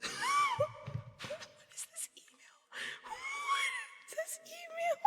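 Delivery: very high, squealing laughing fit; helpless laughter. Real, not acted. Streamer cracking up over an email just read, helpless laughter. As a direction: squeeze the words out through real laughter.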